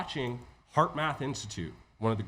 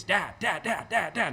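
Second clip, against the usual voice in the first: put-on nagging kid; 5 semitones higher, 4 dB louder, slower than usual. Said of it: "parent has experienced this with their kids".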